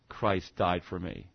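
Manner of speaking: slow, soft and low, letting each phrase land; hushed reverent preaching. Acted. Preacher tells the congregation of the cross in hushed awe. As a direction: speak of the cross in a hushed, awed voice.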